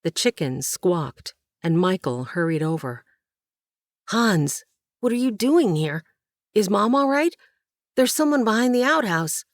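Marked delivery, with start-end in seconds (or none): 4.1-9.5 put-on high, worried boy's voice; a scared kid asking fast; anxious boy.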